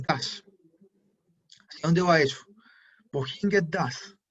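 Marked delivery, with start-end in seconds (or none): 3.1-4.2 German phrase said slowly for students; slow and clear. Shown as a demonstration. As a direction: spell the sentence out calmly, word by word. Repite la frase alemana despacio. (frase alemana lenta y clara para la clase)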